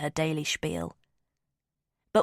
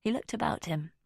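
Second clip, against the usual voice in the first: ordinary delivery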